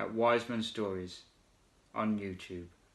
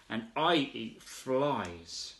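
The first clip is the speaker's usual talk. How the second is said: slow proud; 4 dB quieter, much slower than usual. Acted. Frog's proud reply.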